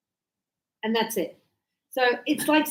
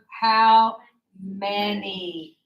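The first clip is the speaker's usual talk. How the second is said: "how many" drawn out, word by word; slow teacher modelling; 4 semitones lower, much slower than usual. Shown as a demonstration. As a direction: stretch "how" and "many" with a gap between. Teacher models plural question word.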